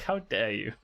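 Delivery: ordinary delivery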